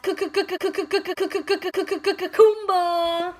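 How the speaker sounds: high, loud repeated Ku rising to a shout; stuttering build-up. Acted. Drumroll build before ride name.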